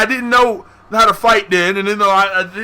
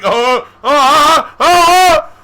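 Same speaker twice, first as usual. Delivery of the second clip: high, loud wails climbing in alarm; hammed-up dread, half laughing; mock panicked wailing; 8 semitones higher, 8 dB louder, much slower than usual. Kid caught fighting by the principal, mock wailing panic.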